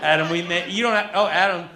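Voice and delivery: ordinary delivery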